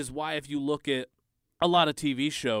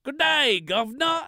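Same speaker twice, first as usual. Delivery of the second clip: loud, very high mock British accent; Graham Elwood's botched Chinese accent; 13 semitones higher, 8 dB louder than usual. Mimicking a podcaster's wrong accent, badly faked and shrill British. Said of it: "Oh, my Chinese accent".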